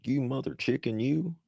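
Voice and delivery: a hammed-up pissed-off Southern cuss; Southern accent. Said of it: "I get real Southern".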